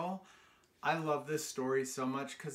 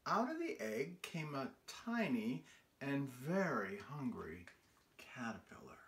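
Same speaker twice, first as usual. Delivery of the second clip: drops to a whisper on hungry caterpillar; hushed; 5 semitones lower, 7 dB quieter than usual. A narrator's reading. Reading to children, fading into a whisper. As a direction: let the words sink into a secret whisper.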